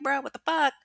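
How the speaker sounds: high-pitched incredulous reaction; mock disbelief. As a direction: exclaim it like you can't believe it. Voicing the incredulous reply she advises.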